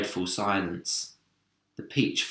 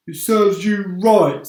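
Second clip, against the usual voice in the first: slow high smug earthworm; 9 semitones higher, 10 dB louder, slower than usual. Acted. Earthworm scolding the centipede, slow smug voice. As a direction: drag it out, pleased. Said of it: "said the earthworm".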